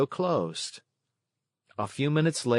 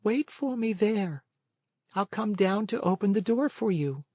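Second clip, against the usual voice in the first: snail; 10 semitones higher than usual. A narrator's reading. Snail speaking.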